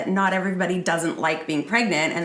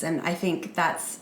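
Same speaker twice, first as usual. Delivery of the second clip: ordinary talk, nothing marked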